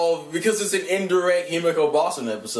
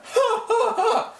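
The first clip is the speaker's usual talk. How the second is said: slow line breaking into high laughter; cracking up; slower than usual. Real, not acted. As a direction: burst out laughing at your own joke. Laughs at own joke.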